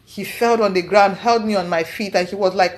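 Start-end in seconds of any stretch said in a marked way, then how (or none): none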